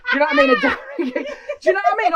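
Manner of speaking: high, loud, laughing aside; real giggles pushing the voice up. Real, not acted. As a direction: crack up while checking they get it. Cracking up at own joke.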